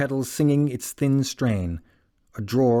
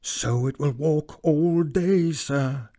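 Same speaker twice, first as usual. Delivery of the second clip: ordinary delivery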